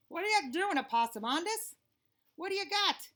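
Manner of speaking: curious Alligator. Acted. Alligator quizzing Opossumondus on the road, curious. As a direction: poke at him, curious and nosy.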